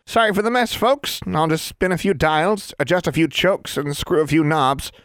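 a bouncy tiny tradesman voice; cheery little wizard. Acted. Small wizard introducing himself to the group, chipper.

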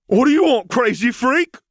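very high, loud bully yell; bully shout. A narrator's reading. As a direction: bark it right in his face. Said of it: "he shouted into Colin's face".

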